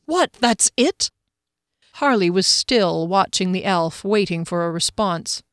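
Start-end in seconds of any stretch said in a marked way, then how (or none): 0.1-1.1 slow, incredulous question; can't believe it's over; frantic disbelief. A narrator's reading. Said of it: "with a frantic look in his eye".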